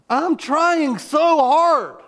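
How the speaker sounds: reliving screaming at God in the car; anguished cry. Retold or imitated. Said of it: "I'm screaming and crying".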